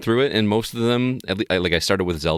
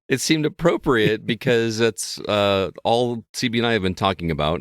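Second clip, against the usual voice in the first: ordinary talk, nothing marked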